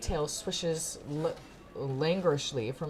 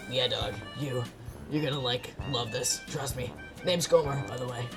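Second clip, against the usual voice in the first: low, lightly raspy trickster voice; lightly voiced shady hustler, pitched down; a hint of Beetlejuice; sly alley cat; 5 semitones lower than usual. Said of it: "sounds like Beetlejuice".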